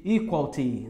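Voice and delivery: EQUALITY shortened to "equal-ti"; wrong form without I. Shown as a demonstration. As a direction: drop the middle I, clear T: "equal-ti". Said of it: "Não vai ser simplesmente". ("equality" errado, sem o I: "equal-ti")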